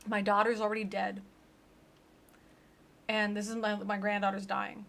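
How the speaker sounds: normal talking voice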